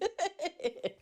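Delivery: high, loud burst of laughter; high giggle. Real, not acted. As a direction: giggle at your own silliness.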